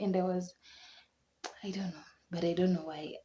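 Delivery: normal talking voice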